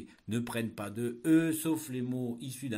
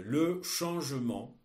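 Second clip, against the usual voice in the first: dictating; 3 semitones higher, 5 dB louder, slower than usual. Shown as a demonstration. Teacher dictating a text.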